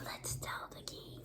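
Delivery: hushed whisper of a plot; sneaky whisper. Acted. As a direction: whisper it like a scheming plan. Plotters whisper their plan.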